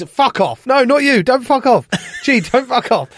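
loud, high laughing yell; shouting. Real, not acted. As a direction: shout them down, half laughing. Host yells at interrupting guest.

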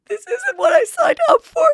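high, whiny mock sob; imitating a teary wife, squeaky and high; mock crying. Wife mocked by a vlogger, high whiny fake crying. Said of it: "she's always crying and she's like".

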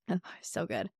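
normal talking voice

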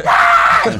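high, loud shriek; mock scream of pain. Child under grandpa's fingernail, screaming. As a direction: imitate a kid shrieking in pain.

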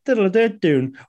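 hummed countdown jingle. Retold or imitated. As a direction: hum the timer music like a little fanfare. Imitating the video's countdown jingle.

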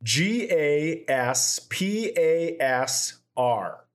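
normal talking voice

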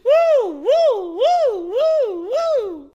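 loud, high repeated woos; high whooping. Real, not acted. Woman cheering big man talk, high loud whoops.

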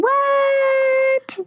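high, long-held squeal; thrilled, drawn-out reaction; excited disbelief. Real, not acted. Reacting to trip news.

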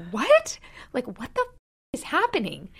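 jolted by her dad's words on the phone; shocked disbelief. Retold or imitated. Sister hearing her father's news, shocked high cry. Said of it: "And I'm like".

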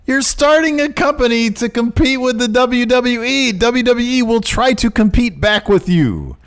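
high-pitched, drawn-out protest; exasperated rant. Real, not acted. Co-host answering the wrestler's tweet, high exasperated rant. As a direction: plead it in a high, strained pitch.

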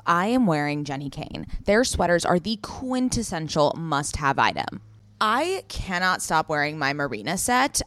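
upbeat sponsor read. Acted. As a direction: sell it like a radio spot.

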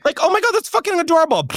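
high, fast gushing squeal. Real, not acted. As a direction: swoon over how cute it is. Gushing over imagined shy partner.

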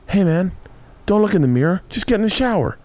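gently steer him away from the mirror; little brain coaxing. Retold or imitated. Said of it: "you hear little brain go".

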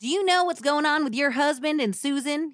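acted angry husband on the phone; demanding. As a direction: confront her in a hillbilly twang. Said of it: "he demanded".

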